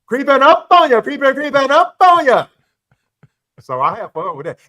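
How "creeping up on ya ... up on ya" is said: loud, higher sing-song taunt; mock trash-talk call. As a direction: needle them, playful and loud. Teasing friends about his weight loss.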